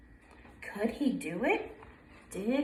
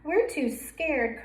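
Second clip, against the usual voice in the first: scared ducklings crying; 6 semitones higher, slower than usual. Acted. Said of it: "cried Waddle".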